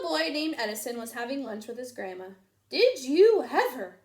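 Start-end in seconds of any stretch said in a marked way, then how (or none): 2.7-4.0 astonished. A narrator's reading. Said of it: "Grandma gasped".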